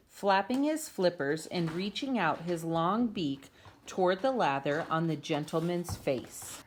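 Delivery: ordinary delivery, nothing marked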